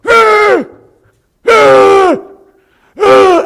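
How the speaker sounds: put-on a boy's high, loud, repeated startled screams; piercing high cries of fright, replayed in full; imitated screams. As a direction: scream out each Ah high and loud, like a scared child. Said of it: "Gabriel jumps out and he goes".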